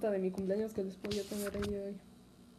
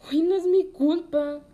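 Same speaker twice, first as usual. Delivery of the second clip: exaggerated protest; 7 semitones higher, 9 dB louder than usual. Dramatic protest at parents.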